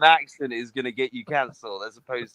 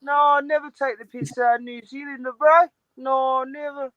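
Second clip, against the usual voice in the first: high-pitched mock Kiwi protest; hammed-up high New Zealand voice; New Zealand accent; 9 semitones higher than usual. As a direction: plead innocence in a squeaky Kiwi accent. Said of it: "can you do the New Zealand accent?".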